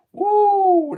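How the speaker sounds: high, loud cheer; excited whoop. Real, not acted. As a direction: whoop for the viewer. Cheering a viewer's love heart.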